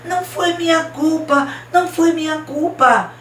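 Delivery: high-pitched acted wail; tearful protest. A narrator's reading. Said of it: "chorava tanto, soluçava". (protesto choroso encenado, voz aguda)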